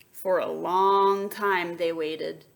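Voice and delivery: ordinary delivery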